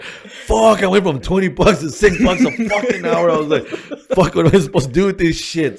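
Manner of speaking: high, loud, laughing curse; exasperated and amused; laughing at his own bad pay; laughing exasperation. Retold frustration, laughing.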